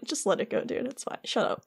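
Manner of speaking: softly dismissive imitation, fading away. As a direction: play someone quietly waving off a quarrel. Weary peacemaker voiced in retelling.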